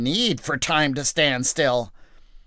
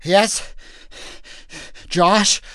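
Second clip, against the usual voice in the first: put-on raised, startled young soldier; startled lieutenant; 7 semitones higher, slower than usual. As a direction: snap to attention, alarmed. Caleb answering Joshua, startled.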